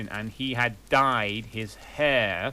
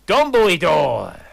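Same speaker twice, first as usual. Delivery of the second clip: dramatic reveal; 5 semitones higher, 10 dB louder than usual. Acted.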